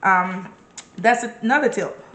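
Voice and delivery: ordinary delivery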